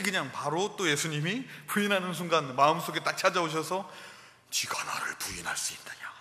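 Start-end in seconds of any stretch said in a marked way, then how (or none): 4.5-6.1 low whisper; what Jesus did not say to Peter, whispered; hushed question. Retold or imitated. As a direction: breathe it out as a whisper. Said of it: "이러지 않으셨어요".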